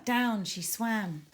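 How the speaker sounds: usual speaking voice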